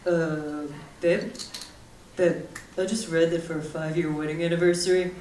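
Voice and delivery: husband sheepishly proposing a wooden anniversary gift; dopey husband voice. Retold or imitated. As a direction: play the clueless husband gently. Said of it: "he comes up to me and he was like".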